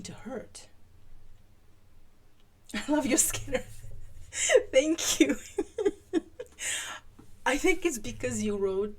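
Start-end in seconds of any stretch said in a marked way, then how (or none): 2.7-9.0 real laughter through the words, higher and louder; laughing. Real, not acted. Reader cracks up over Skinner in the story, laughing. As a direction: crack up while talking.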